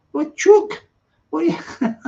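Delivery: high-pitched mock Scouse, breaking into laughter; Liverpool accent. Teasing the Liverpool guest who caught the slip, mock Liverpool accent. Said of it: "or whatever he's saying in Liverpool".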